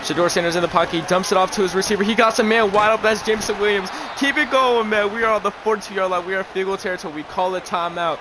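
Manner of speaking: fired-up game call; hyped play-by-play. Acted. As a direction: call it like a live broadcaster who can't sit still.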